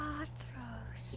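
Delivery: breathy awed whisper. Acted. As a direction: whisper the name in awe. Awed repeat of a name.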